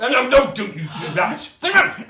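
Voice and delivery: loud, jumping high on the order; mock scolding. Magician orders the volunteer to sit down, loud mock scolding. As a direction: tell them off loudly in jest.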